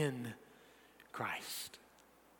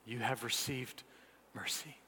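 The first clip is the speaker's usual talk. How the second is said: low, soft benediction with long pauses; hushed preaching; 7 semitones lower, 14 dB quieter than usual. Real, not acted. Preacher sending believers to live in mercy, hushed. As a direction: send them out quietly, word by word.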